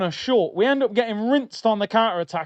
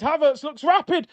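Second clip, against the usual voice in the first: excited; 7 semitones higher than usual. Real, not acted. First take before scream.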